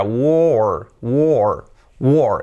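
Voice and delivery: "war" stretched as "wo-or", low and falling; separated sounds. Shown as a demonstration. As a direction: pull the W-O and R of "war" apart. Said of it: "separamos un poco más los sonidos". ("war" con sonidos separados, o alargada)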